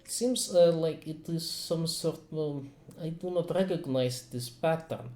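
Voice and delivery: put-on thoughtful doctor, light Russian accent. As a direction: ponder the results in a Russian accent. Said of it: "I like my Russian accent".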